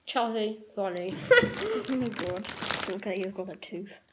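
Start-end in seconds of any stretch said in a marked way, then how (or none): 0.0-1.1 slow, words mashed by marshmallows; mouth full. Real, not acted. 1.2-1.8 sudden high squealing laugh; high burst of laughter. Real, not acted. Laughs at friend's attempt.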